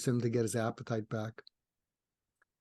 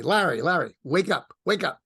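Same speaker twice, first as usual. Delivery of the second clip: raised, higher calling voice; calling to wake him; 5 semitones higher, 6 dB louder than usual. Retold or imitated. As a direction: loudly rouse a sleeping friend. Waking dead mentor in story.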